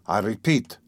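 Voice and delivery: plain reading voice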